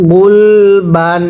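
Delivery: গুলবান stretched very slowly, ending on a dental n; teacher modelling গুলবান slowly for students; slow drawn-out reading. Shown as a demonstration. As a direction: read গুলবান syllable by syllable, slowly, with a clear final n. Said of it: "তানবিনের উচ্চারণটা বাংলায় শেষে দন্তন্য উচ্চারণ হবে".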